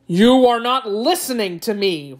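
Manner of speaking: tiger's roar. A narrator's reading. Tiger losing patience with the jackal, contained storybook roar. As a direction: burst out as an exasperated tiger. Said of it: "roared the tiger".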